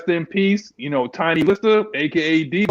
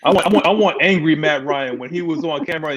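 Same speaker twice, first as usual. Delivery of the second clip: ordinary talk, nothing marked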